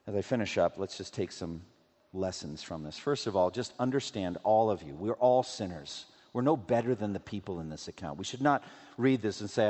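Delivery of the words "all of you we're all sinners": ordinary talk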